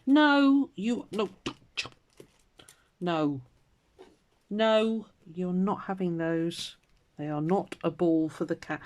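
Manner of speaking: real exasperated cat scolding; telling off the cat. Real, not acted. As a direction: scold the cat away from her things. Scolding cat taking her things.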